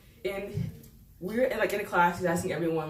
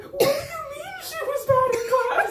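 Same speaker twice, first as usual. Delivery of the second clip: high, loud, laughing imitation of a tearful mom; upset mom; 5 dB louder than usual. Imitating mom crying at the parent-teacher meeting, high and loud. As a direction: wail it like a mom bursting into tears. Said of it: "She's like".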